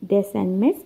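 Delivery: normal talking voice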